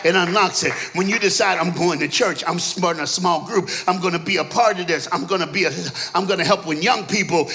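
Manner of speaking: rising shouted preaching over the organ; full-throated revival preaching; preaching at full force. Acted. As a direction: build it to a roaring climax. Preaching climax with music.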